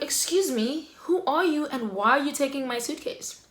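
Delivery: indignant challenge. Retold or imitated. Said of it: "I'm like".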